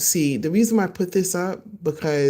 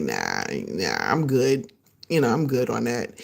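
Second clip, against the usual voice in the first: imagined dismissive guy; 6 semitones lower, 4 dB quieter than usual. Retold or imitated. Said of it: "He's gonna be like".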